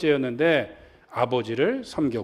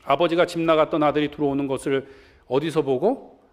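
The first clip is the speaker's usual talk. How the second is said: ordinary talk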